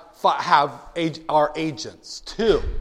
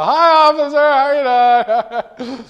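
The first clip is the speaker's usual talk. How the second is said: mock gleeful greeting; 9 semitones higher, 9 dB louder than usual. Speeder waving at officer changing a tire, hammed-up gleeful shout. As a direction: holler it gleefully while zooming past.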